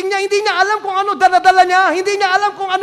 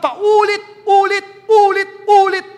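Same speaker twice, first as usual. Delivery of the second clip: drawn out; 4 semitones higher, 6 dB louder, much slower than usual. Real, not acted. Preacher stretching repeated words.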